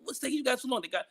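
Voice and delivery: a hint of an irritated store owner; mock complaining customer. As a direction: grumble at the cops as the robbed owner. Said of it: "started complaining with him, like".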